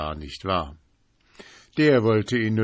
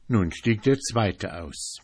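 plain reading voice

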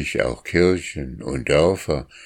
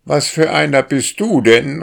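much higher, louder indignant drake; calling back; 9 semitones higher, 7 dB louder than usual. A narrator's reading. Drake calls up to the passing boy, loud and indignant. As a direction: shout up at the boy, affronted. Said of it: "rief der Enterich".

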